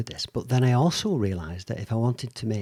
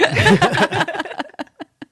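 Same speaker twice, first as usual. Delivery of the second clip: high burst of delighted laughter; big laugh; 4 dB louder than usual. Real, not acted. Host laughing at how neatly the guest made her point, big laugh. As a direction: laugh out loud, high and free.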